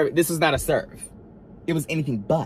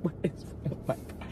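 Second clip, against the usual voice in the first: much softer, breathy and voiceless; whispered repeat; 2 semitones higher, 14 dB quieter than usual. Retold or imitated. Muttering to herself about the broken car, whispered. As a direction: say it under your breath in despair.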